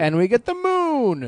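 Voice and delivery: loud, high, slow boast; grandiose shout. Pretend. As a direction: proclaim it like a conqueror. Mock grandiose Israel voice.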